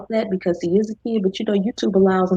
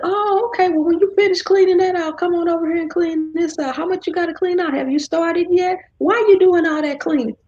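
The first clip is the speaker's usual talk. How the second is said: much higher, bouncy teasing chatter; playful teasing; 11 semitones higher than usual. Retold or imitated. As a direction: tease her about the cleaning. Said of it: "And I said".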